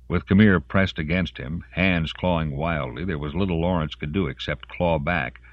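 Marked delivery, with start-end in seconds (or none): none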